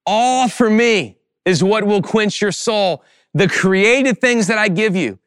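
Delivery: high, emphatic preaching as God's own plea; impassioned God. Acted. God calling a bored believer to look up, impassioned. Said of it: "God is pulling you to himself".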